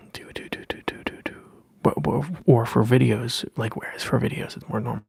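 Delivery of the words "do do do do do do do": whispered mouth sounds. Acted.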